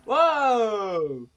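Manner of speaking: long, loud wow starting high; loud exclamation. Real, not acted. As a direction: belt out a drawn-out wow. Exclaiming at the game action.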